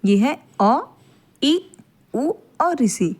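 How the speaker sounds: short vowels अ, ई, उ, ऋ said crisp and brief; short vowels अ ई उ ऋ, each one crisp and brief; short vowels demo. Shown as a demonstration. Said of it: "तो देख लीजिए".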